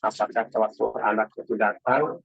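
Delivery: usual speaking voice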